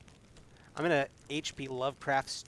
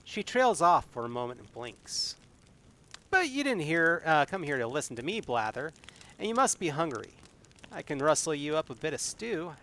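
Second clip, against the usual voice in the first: story narration; 3 semitones higher than usual. A narrator's reading. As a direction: read the adventure like a tale to the audience. Reads gamebook narration with May's lines.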